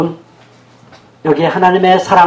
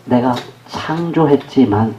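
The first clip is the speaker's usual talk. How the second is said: slow, lower-pitched sorrowful quote; poignant; 5 semitones lower, 4 dB quieter, slower than usual. Acted. God grieving over what was created, poignant. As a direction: linger sadly over each word, low and slow. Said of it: "정말 짠한 구절은 뭐냐면요".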